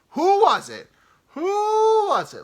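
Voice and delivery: very high loud furious yelling; driver going off. Retold or imitated. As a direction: scream the question at everyone. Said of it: "this dude's going off".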